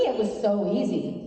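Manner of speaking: ordinary talk, nothing marked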